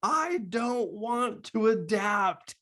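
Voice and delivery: put-on high-pitched, drawn-out toddler whine; imitated child's tantrum cry, stretched out slowly. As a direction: wail it like a small kid mid-tantrum. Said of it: "he yells out".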